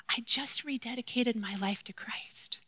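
soft unvoiced secret confession; hushed teacher confiding her faith; whispered.

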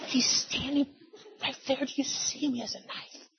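high, hushed, fading to a whisper; terrified whisper. Retold or imitated. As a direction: point out the intruder in fear. Wife's night terror, acted.

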